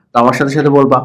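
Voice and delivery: normal talking voice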